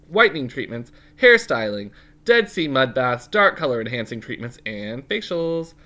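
bright energetic sponsor pitch; ad read. Acted. Co-host reads the groomer ad to listeners. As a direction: pitch it like a commercial.

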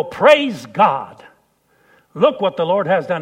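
loud, triumphant praise; loud praise after surviving; joyful shout. Retold or imitated. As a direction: shout thanks to God on landing. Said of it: "I go".

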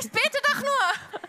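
high, slow comic self-defence; mock protest. Mother caught praying by her daughter, high mock protest. Interpreter mirrors the defensive reply.

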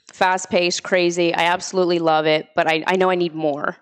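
usual speaking voice

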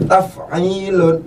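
Taf'ilun stretched out slowly; slow pattern demo. Shown as a demonstration. Teacher slowly pronounces the pattern Taf'ilun. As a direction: model the pattern Taf'ilun carefully. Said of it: "wazan taf'ilun".

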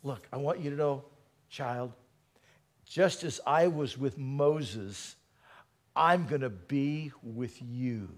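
put-on a hushed, tender voice of God speaking to a child; God speaking softly. Preacher voices God's quiet reassurance, hushed and tender. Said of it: "If God were to say to you".

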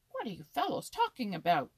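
sharp scolding. A narrator's reading. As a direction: butt in loudly, sharp and scolding. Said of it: "a sharp, scolding voice".

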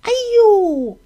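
sudden high, loud cry of pain; pained yelp "哎呦". A narrator's reading. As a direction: yelp as a tooth bites down. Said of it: "就大叫了一声". (小金鱼咬到牙疼地尖叫)

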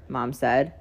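ordinary delivery, nothing marked

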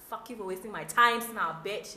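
loud mock-angry cursing; resentful smile unmasked. Host's inner curse at a time-waster, mock furious. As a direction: spit out the resentment behind the smile. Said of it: "my smile is not a".

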